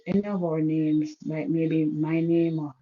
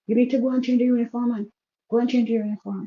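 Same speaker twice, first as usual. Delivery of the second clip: a fast, louder, higher telling-off from the husband; the husband snaps at his wife to go change, raised and quick; annoyed father; 7 semitones higher, 4 dB louder, faster than usual. Retold or imitated. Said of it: "he was a bit angry".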